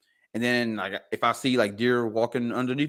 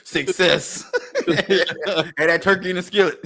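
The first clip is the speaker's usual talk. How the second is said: higher, louder, through laughter; laughing jibe; 6 semitones higher, 3 dB louder than usual. Real, not acted. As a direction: crack up while teasing. Joking, laughing about store turkey.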